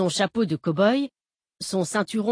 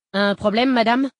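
firm, raised cop voice; 5 semitones higher, 6 dB louder than usual. A narrator's reading. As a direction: call out like a highway cop. Cop calls out to stopped driver.

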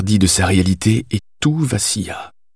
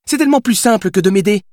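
high-pitched reproach at normal volume; mild indignant cry; 11 semitones higher, faster than usual. A narrator's reading. Billy reproaches the writer for not helping, indignant. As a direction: protest in a high, hurt character voice. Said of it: "cria-t-elle".

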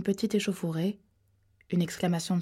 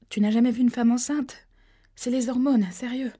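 higher, louder indignant excuse; heated protest; 5 semitones higher, 4 dB louder than usual. Acted. Pregnant girl defending herself to an angry man, heated. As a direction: protest loudly, scrambling to explain.

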